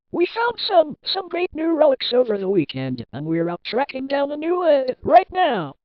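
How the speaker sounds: high, hyped-up welcome; excited phone caller. Acted. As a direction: gush with big swooping high pitch. Plays excited phone caller, game three.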